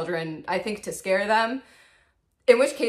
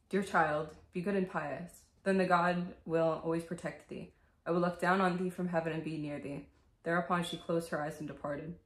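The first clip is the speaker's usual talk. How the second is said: bedtime story reading; 7 dB quieter than usual. Acted. Vlogger reading Grimm's Cinderella to viewers, soft storybook voice. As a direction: read it like a scary bedtime tale.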